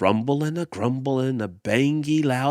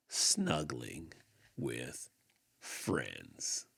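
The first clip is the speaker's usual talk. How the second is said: softer and lower down to a hush; fading ending; 10 semitones lower, 15 dB quieter, much slower than usual. A narrator's reading. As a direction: let the story drift off to sleep. Gentle story ending fades out.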